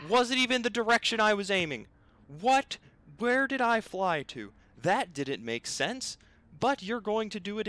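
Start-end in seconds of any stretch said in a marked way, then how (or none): none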